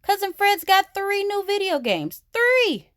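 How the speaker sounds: put-on high, excited boy cub bragging; boastful brother. A narrator's reading. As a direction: top his sister's brag, punch the last word.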